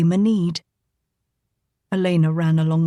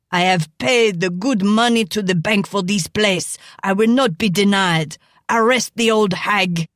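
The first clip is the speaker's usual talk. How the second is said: angry shouting. Acted. Frenchman shouts demands.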